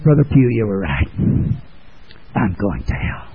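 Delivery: lightly voiced confession. A hint of a sinner confessing to the preacher, quiet. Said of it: "you can tell me".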